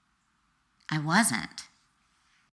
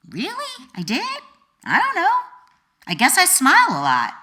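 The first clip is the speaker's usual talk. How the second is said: much higher, bewildered replies; surprised younger self; 11 semitones higher, 6 dB louder than usual. Retold or imitated. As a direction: play her younger self, caught off guard. Her younger self answers, surprised.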